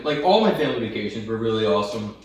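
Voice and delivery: normal talking voice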